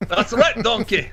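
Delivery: a light Shrek impression. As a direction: tease like Shrek scolding Donkey. Quoting Shrek after the accent joke.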